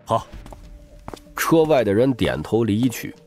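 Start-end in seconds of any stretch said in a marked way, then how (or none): none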